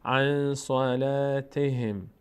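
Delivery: "عن صلاتهم" slowly, N held in the nose; hidden nun. Shown as a demonstration. Teacher reads the whole phrase, nasal hidden nun. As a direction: hum the N of "عن" into "صلاتهم".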